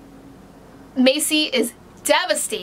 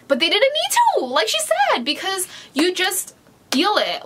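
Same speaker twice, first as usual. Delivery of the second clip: much higher, squealing delight; gushing excitement; 8 semitones higher than usual. Real, not acted. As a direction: gush about it like a fangirl. Gushing about the romance.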